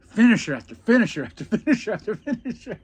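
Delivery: real laughter breaking into a fast, loud list; laughing through it. Real, not acted. Recalling the plan told to his brother, laughing hard. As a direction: rattle it off while cracking up.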